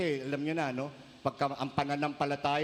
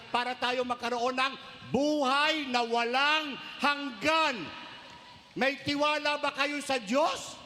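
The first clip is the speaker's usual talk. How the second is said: preaching; 7 semitones higher than usual. Acted. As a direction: drive the question home to the crowd. Preaching at full force.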